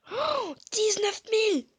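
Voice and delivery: cri de joie très aigu et fort, étiré; s'exclamer, émerveillé par le gros butin; surpris et ravi. Real, not acted. Reaction au coffre de nourriture. (exclamation enthousiaste, aiguë et forte)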